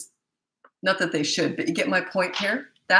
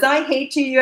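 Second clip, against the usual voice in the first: mock child outburst; 8 semitones higher, 4 dB louder, slower than usual. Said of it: "If he says".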